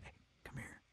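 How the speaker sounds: hushed urgent whisper. Retold or imitated. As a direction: whisper it so nobody hears. Secretly telling a friend mid-show.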